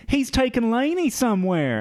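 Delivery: high, urgent imitated warning; mock alarm. Girl warning Zach about Lainey, mock alarm. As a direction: cry it out with hammed-up alarm. Acts out Anna Paquin's alarm.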